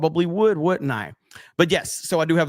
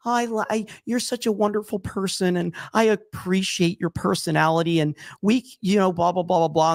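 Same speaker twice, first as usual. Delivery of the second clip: higher, gushing mock voice; mock sweet suitor; 4 semitones higher than usual. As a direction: fawn over her, hammed-up. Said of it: "they're, like, all sweet".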